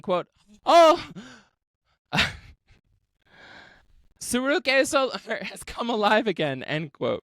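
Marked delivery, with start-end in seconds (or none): 0.7-6.6 mock yell. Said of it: "she then started yelling".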